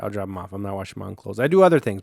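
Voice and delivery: normal talking voice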